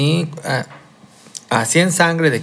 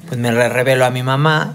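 usual speaking voice

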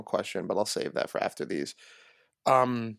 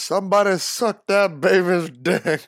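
mic shout quote; 8 semitones higher, 8 dB louder, slower than usual. Retold or imitated. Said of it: "got on the mic and says".

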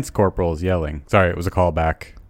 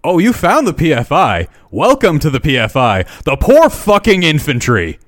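louder, higher mocking drill yell; drill sergeant yell; 7 semitones higher, 5 dB louder than usual. Lance corporal mocks a recruit who thinks it's a mistake, louder and higher. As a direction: sneer and shout like a drill sergeant.

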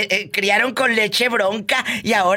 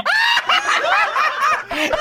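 high whooping laugh. Real, not acted. Host laughs at catchphrase.

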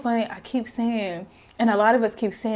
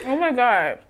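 mouth full; 4 semitones higher, 5 dB louder than usual. Real, not acted. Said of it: "I'm sorry, I'm eating this".

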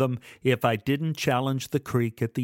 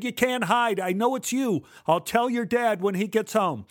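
taunting threat; 9 semitones higher than usual. Acted. Kid threatening the thief.

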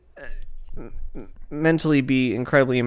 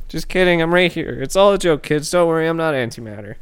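usual speaking voice

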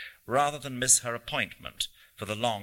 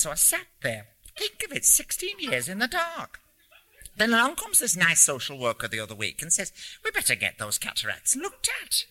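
put-on high-pitched North Country old lady; chatty North Country accent, rising to excited peaks; 10 semitones higher than usual. A narrator's reading. Old lady telling a stranger about her blindness, North Country accent. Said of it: "in an English North Country accent".

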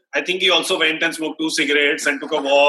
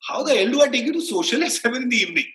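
incredulous; 6 semitones higher, faster than usual. Retold or imitated. Retort retold to a star.

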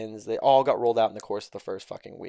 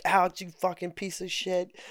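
mock angry holder; 7 semitones higher than usual.

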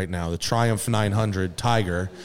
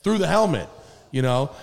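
ordinary talk

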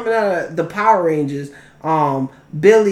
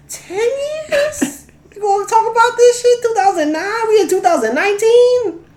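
high, laughing disbelief; can't believe it's taken this long; high-pitched indignant disbelief with a laugh; incredulous; 14 semitones higher than usual. Real, not acted. Incredulous ranting at the delay.

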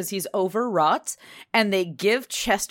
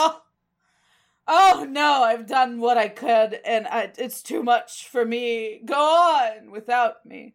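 hammed-up tragic swoon, louder and drawn out; fainting couch drama; 3 semitones higher, 4 dB louder, slower than usual. Cat on a fainting couch giving up, hammed-up tragic. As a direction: sigh it out like a dying diva. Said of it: "up on a fainting couch".

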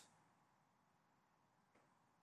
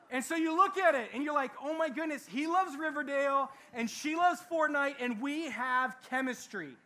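excited gushing; 6 semitones higher, 7 dB louder than usual. Retold or imitated. Mocking infatuated friends' excitement.

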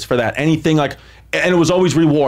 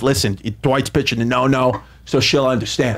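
lower, calm dad insisting it's fine; dad's voice; 6 semitones lower than usual. Retold or imitated. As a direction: play the dad brushing it off. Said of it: "He goes".

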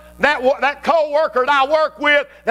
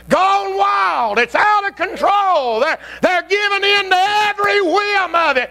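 preaching shout; 9 semitones higher than usual. Real, not acted. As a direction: raise it to a full pulpit roar.

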